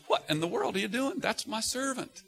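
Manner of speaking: a lightly voiced high rebuking Lord; the Lord scolding a judging believer; the Lord speaking. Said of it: "The Lord looks down and says".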